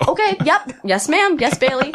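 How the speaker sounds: high, bright eager-to-please replies; eager yes ma'am. Retold or imitated. Trainee snapping to attention for the coaches, eagerly high. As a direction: chirp them back like a keen recruit.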